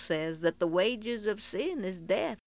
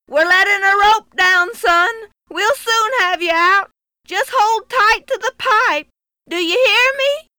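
loud, high call down a well; 11 semitones higher, 13 dB louder than usual. Acted. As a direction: call down to a trapped boy. Cowboy calls down the well.

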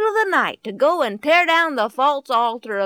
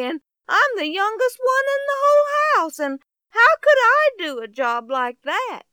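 put-on higher, stammering, a timid young man's voice; flustered Gideon; 7 semitones higher, 6 dB louder than usual. Youngest son objecting to the angel, higher flustered stammer. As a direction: protest in flustered disbelief.

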